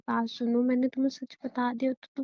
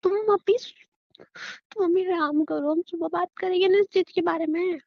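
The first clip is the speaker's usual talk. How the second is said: much higher, tense coaxing; anxious pleading; 6 semitones higher than usual. Acted. Wife nervously coaxing her husband to rest, anxious plea. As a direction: beg him to rest.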